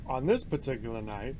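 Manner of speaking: ordinary delivery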